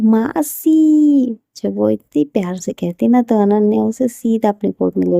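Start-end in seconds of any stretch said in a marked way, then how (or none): 0.0-1.4 high, loud, long drawn-out मासी; joyful shout. A narrator's reading. Little girl spots her aunt, shouting happily. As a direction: call your aunt across the room with delight. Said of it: "जोर से चिल्लाती होती है".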